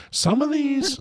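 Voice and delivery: chipmunk-high processed voice; pitch-shifted voice. Real, not acted. Pitch plugin on his voice.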